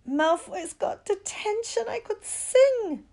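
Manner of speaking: high, loud, drawn-out singsong glee; gleeful. A narrator's reading. Girl celebrates rival's punishment, gleeful. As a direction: crow it slowly in a high voice. Girl gloats, dancing a jig.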